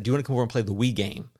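usual speaking voice

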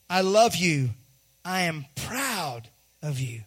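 slow, low and deliberate; tender fatherly affirmation. Acted. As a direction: let each word land, like a father to his child. Father slowly affirms his child.

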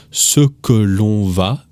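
"l'on" kept full, said slowly; slow formal form. Shown as a demonstration. Teacher to learners, slow careful formal French form. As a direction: stretch out "ce que l'on va".

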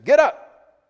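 sudden high, loud command; acted shout of reproof; sharp rebuke. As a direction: burst out as an angel ordering a man to his feet. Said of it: "the angel rebukes him".

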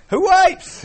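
very high, loud comic squeal; King Julian. Acted. Lemur king answering the appalled lion, high comic squeal. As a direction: shoot the question way up high, loud. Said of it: "And Julian's like".

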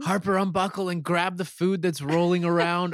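mom instructing. Retold or imitated. Mom ordering kid in minivan.

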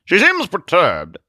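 high, slow, stately remark; note it with affected gravity; grandly concerned crusader; observing Zealot. Acted. Zealot comments on Truth.

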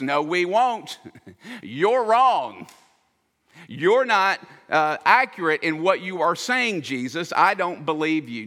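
hammed-up stubborn Peter talking back to Jesus; mocking defiance. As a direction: play Peter scoffing, sure he's right. Peter arguing with Jesus, mocked.

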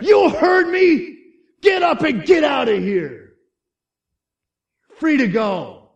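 loud, high shouted order; guard's yell. Acted. Guard ordering prisoner out of the cell, yelling. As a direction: bellow it into the cell. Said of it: "he yells in there".

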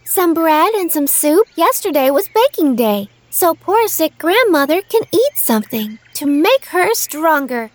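put-on high girl voice; little girl voice. A narrator's reading. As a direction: answer as a small girl. Girl answering the wolf.

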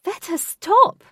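high, drawn-out loud shout. A narrator's reading. Katie to the cantering horse, shouting. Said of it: "shouted Katie".

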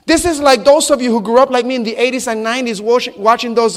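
ordinary delivery

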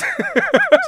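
high, loud burst of laughter; laughing. Real, not acted. Host joking about pansexuals to co-host, high laughter. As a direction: crack up at your own joke.

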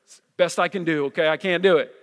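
ordinary talk

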